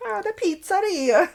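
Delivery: high, slow cartoon Italian accent; Mario-style Italian accent. Podcast host imagining the stroke patient's new voice, mock Italian accent. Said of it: "Mario Luigi on Mario Brothers, that kind of accent".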